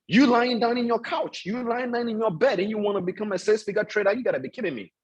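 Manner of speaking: high-pitched, insistent telling-off, dropping at the end; incredulous scolding. Real, not acted. Coach to trainees lying down on his call, incredulous scolding. Scolds listeners for lounging on calls.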